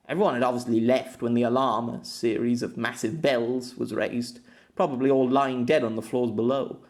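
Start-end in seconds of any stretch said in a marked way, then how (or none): none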